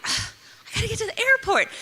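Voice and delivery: high, whiny ugh of frustration; high exasperated whining to herself; frustrated whine. Retold or imitated. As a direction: whine it up high like a stranded girl. Frustrated self-quote, car trouble.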